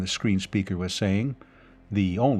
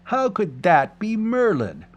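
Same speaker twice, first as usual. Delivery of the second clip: higher scoffing question; scornful; 11 semitones higher, 4 dB louder than usual. A narrator's reading. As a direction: scoff at the very idea. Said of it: "cried scornfully".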